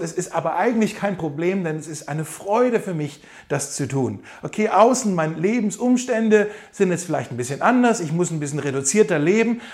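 normal talking voice

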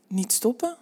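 quoted back with a rising tune; teasing quote. A narrator's reading. Rick repeating his lover's plea, mildly arrogant. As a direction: echo it as a mild taunt, voice rising. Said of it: "Ik dacht dat je zei".

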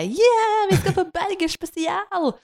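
high, loud mock-children's cheer; imitating cheering kids. Storyteller imitates children excited about the party drink, gleeful cheer. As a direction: squeal it like kids thrilled about a party treat. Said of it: "Mens ungene bare".

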